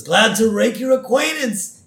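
high, gleeful villain voice; menacing pun delivered with relish; hammed-up cartoon villain greeting; Willie. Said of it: "And so Willie says".

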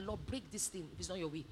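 very low, soft aside prayer; retold private plea. Friends praying on the wedding day, low hushed plea. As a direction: pray it under the voice, almost to yourself. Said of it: "some of us still cried to".

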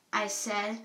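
ordinary delivery, nothing marked

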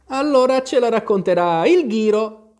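high, loud storybook shout. A narrator's reading. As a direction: cry out eagerly as if in chorus. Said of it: "gridarono all'unisono".

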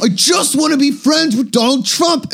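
put-on high, drawn-out childish plea; slow, high-pitched mock whine; petulant son. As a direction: plead with Dad like a whiny kid. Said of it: "He can almost be like".

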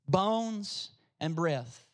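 bones and breath, drawn out very slowly; solemn, deliberate repeat of the title. Shown as a demonstration. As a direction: let each word sink in, slowly.

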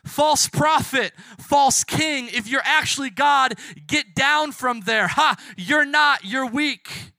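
loud, high crowd taunts; yelling jeers. Acted. Crowd yelling at Jesus from the hill's bottom, jeering. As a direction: yell it up the hill in mockery. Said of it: "would be yelling".

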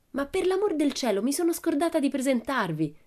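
high-pitched burst of remembering; flustered child's outcry; sudden exclamation. A narrator's reading. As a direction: blurt it out in a high voice. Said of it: "esclamò a un tratto Pippi".

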